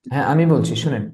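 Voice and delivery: usual speaking voice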